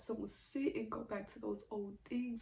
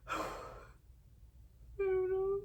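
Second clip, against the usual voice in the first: real crying; 8 semitones higher, 5 dB louder than usual. Real, not acted. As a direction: struggle to get it out through tears. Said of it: "literally crying like how I am".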